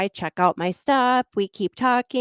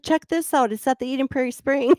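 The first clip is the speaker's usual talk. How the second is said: higher, gleeful and quick; excited; 6 semitones higher than usual. Retold or imitated. Showing her ex-husband the spray-painted curb, gleeful and higher. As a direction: sound delighted to show it off.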